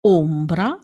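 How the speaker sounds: ombra with a tight, closed O; Italian closed O in ombra. Shown as a demonstration. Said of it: "close sound is".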